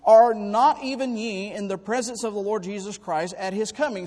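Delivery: slow proclaimed Bible quote; scripture reading. Acted. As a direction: read it out like holy writ. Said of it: "Thessalonians two nineteen says".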